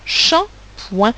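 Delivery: Shampoing broken into "sham" and "poing"; Shampoing in two separate beats for learners; syllable by syllable. Shown as a demonstration. Teacher splits word into syllables.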